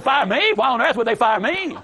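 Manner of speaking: mock-shocked employee protesting her firing; stunned disbelief. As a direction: play the outraged innocent who can't believe it. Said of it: "She was stunned".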